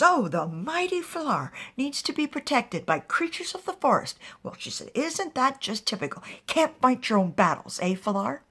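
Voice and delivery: loud, high, taunting scorn; mocking sarcasm. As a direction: jeer at the so-called mighty one. Witch mocks Falar's protectors.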